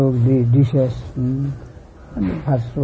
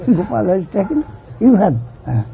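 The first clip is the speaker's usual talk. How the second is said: mother scolding; 6 semitones higher, 3 dB louder than usual. Retold or imitated. Mother accuses child.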